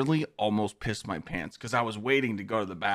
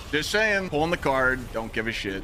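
usual speaking voice

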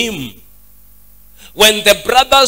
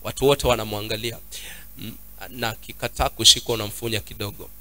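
much lower, much softer voice; quiet aside; 9 semitones lower, 9 dB quieter, faster than usual. Real, not acted. Preacher asking for a child to be held, quiet aside. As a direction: drop out of preaching into a low aside.

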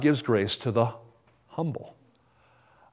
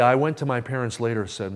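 usual speaking voice

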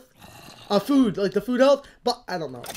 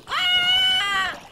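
very high scream; sudden shriek. Real, not acted. Gamer swarmed by zombies, shrieking.

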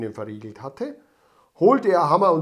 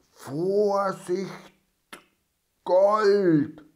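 robber reading slowly, awed; reading the label; 4 semitones higher, much slower than usual. A narrator's reading. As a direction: spell out the sign with greed. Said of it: "las der Räuber Hotzenplotz". (ehrfürchtig vorgelesen)

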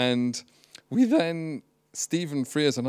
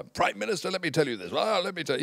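higher, hammed-up regal voice scolding a prime minister; mock queen losing temper; 6 semitones higher, faster than usual. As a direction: parody a queen's outburst, half in jest. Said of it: "losing her temper with Tony Blair".

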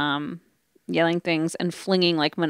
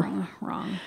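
barely voiced, very soft whisper; hushed admission of being mistaken; whispered aside; 11 dB quieter than usual. Real, not acted. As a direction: repeat it under the voice. Playful verdict on own thinking.

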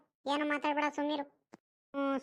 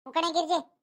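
ordinary talk

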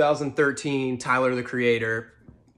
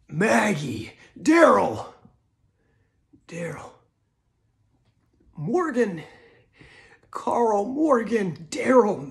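much higher, anguished calling out; Rick Grimes; 7 semitones higher, 6 dB louder, much slower than usual. Retold or imitated. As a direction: cry out each name, voice cracking. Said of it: "impression of Rick Grimes, um, only saying the names".

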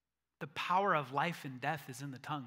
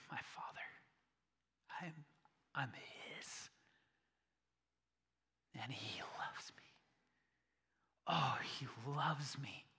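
soft whisper with long pauses; hushed, tender private wonder; awed whisper; 3 semitones lower, 10 dB quieter than usual. Acted. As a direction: whisper it to yourself like a treasured secret. Voices Jesus treasuring Father's words.

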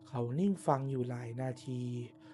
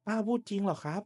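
shocked disbelief; 7 semitones higher than usual. Acted. Shocked by the hanging story.

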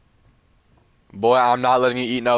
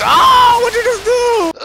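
very high, loud outcry; alarmed squealing shout; shocked yell; 7 dB louder than usual. Pretend. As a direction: shriek in horrified disbelief. Shocked at child's act.

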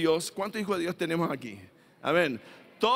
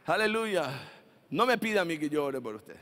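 ordinary talk, nothing marked

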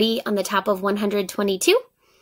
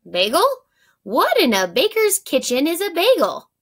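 high, baffled question; a baker amazed at a strange word; puzzled baker; 7 semitones higher, 3 dB louder than usual. Acted. Baker O asks what a bagel is, high baffled voice.

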